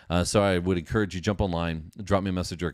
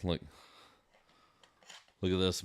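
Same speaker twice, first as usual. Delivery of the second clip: ordinary talk, nothing marked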